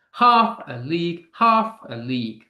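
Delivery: "Half" punched at the head of each phrase; stress on HALF. Shown as a demonstration. Teacher showing where the emphasis lands, stress on "Half". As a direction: hit "Half" hard, let "a league" fall away. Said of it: "The emphasis on the half".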